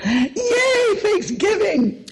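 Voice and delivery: very high, drawn-out mock cheer; playful cheer. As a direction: squeal a slow, high hooray for the holiday. Cheering the holiday plans.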